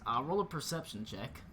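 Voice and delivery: ordinary talk, nothing marked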